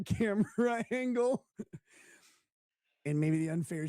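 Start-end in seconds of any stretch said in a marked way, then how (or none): 0.0-1.4 laughing. Real, not acted. Laughs at own tweet.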